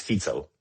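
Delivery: "Fico" said FEET-so, with a ts; correct pronunciation. Shown as a demonstration. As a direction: say "Fico" with the ts of pizza. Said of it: "Like we pronounce pizza".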